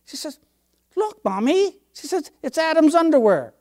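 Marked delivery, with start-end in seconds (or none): 1.0-1.8 put-on high, eager little-boy voice; child voice. Five-year-old showing mommy the page, high and eager. 2.4-3.5 put-on lightly high, innocent little boy; child voice. Boy explaining the leaf to mommy, lightly high.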